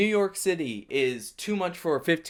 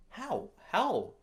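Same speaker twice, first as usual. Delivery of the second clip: incredulous how; 8 semitones higher, 5 dB quieter than usual. Real, not acted. Incredulous at the story.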